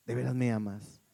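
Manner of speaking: low, doubtful wife's question; doubting wife. Acted. Wife asking husband after some seconds, doubtful. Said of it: "pasan unos segundos y vuelve a decir".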